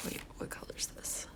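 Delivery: whispered aside to herself; soft thinking-aloud whisper. Real, not acted. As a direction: breathe it out, unvoiced. Aside while painting.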